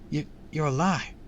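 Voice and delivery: amazed, faltering start; astonished gasp. A narrator's reading. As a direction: gasp it out in shock.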